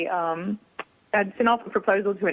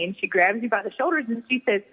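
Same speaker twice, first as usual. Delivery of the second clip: normal talking voice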